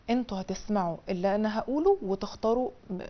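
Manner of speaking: ordinary talk, nothing marked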